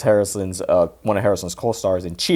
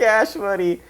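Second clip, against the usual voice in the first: high-pitched, amused echo; comic movie quote; 11 semitones higher than usual. Acted. Podcaster echoes the cash money punchline, high comic voice. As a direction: repeat it high through amusement. Laughing repeat of the movie line.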